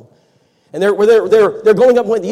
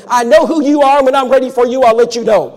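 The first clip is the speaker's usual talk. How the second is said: grouchy neighbor; 8 semitones higher, 8 dB louder than usual. Retold or imitated. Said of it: "He's grouchy".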